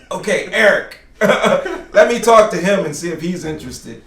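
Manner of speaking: recalling the idea with a laugh; laughing decision quote; laughing self quote. Retold or imitated. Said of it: "I was like".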